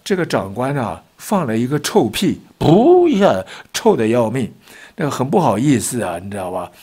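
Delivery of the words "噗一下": a loud, high mouth-made fart pop; a comic puff of a fart sound; fart noise. Retold or imitated. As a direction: blow a quick raspberry like a fart.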